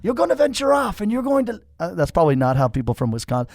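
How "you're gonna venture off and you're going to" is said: prophetic voice. Retold or imitated. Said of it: "people told you in Wisconsin".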